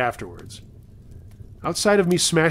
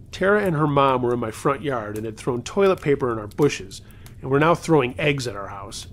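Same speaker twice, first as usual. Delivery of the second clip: plain reading voice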